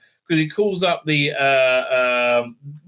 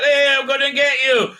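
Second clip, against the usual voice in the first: mock boast; 10 semitones higher, 4 dB louder than usual. Mocking the character's boast.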